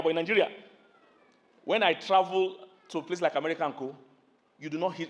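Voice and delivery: usual speaking voice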